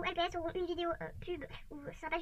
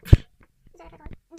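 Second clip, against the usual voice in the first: mock stage whisper; 13 dB louder, much slower than usual. Joking at the end.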